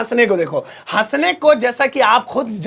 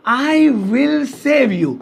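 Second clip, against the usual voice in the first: each word stretched right out; slow dictation; 3 semitones higher, much slower than usual. Shown as a demonstration. Teacher dictating the translation to students, slow dictation. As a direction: draw out "I will save you" very slowly.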